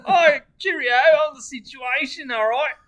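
high, loud mock English, drifting Australian; English accent slipping Australian. Echoing English Jersey Shore joke, loud accent slipping Australian. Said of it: "Australian again".

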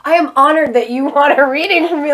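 put-on flattered innocence; playing dumb. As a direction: gush with feigned gratitude, half laughing. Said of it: "I play it so dumb".

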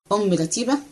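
usual speaking voice

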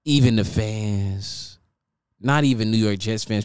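normal talking voice

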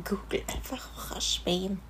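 breathy whispered echo; whispering. Real, not acted. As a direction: whisper it back mockingly. Mocking repeat in whisper.

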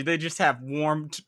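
ordinary delivery

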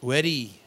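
"Very" said like "weddy"; Indian accent V as W. Shown as a demonstration. Showing the V-to-W swap, "Very" as "weddy". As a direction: say "Very" with a W start and tapped R. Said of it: "V's or W's".

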